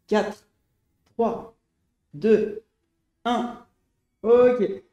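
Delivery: counting down the seconds; coach countdown. Real, not acted. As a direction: call out the countdown.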